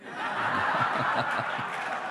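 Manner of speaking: breathy delighted laugh; soft laugh. Real, not acted. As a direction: let out a quiet chuckle. After prayer answered.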